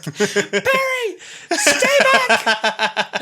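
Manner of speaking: high, loud, laughing yell; mock panicked shout. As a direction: shout a warning through laughter. Acting Doctor shouting from cart.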